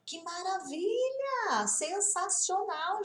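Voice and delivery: high, drawn-out cheer; real delight. Real, not acted. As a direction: sing out your praise for a student. Delighted by student's progress. (empolgada, aguda e alongada)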